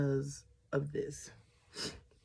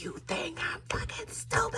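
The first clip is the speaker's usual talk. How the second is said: much higher, strained, breathy disbelief; incredulous fury. Retold or imitated. Confronting her boyfriend in the car, furious. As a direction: hurl it at him in furious disbelief. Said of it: "And I'm like".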